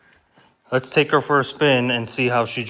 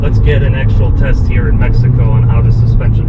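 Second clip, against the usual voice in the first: loud, talking over road noise; raised voice over engine; 11 dB louder than usual. Real, not acted.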